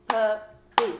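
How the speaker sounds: loud, split into two claps, "Pup-py"; clapped syllables. Shown as a demonstration. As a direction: clap out "Puppy." beat by beat with the class. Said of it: "Now, everyone practice with me".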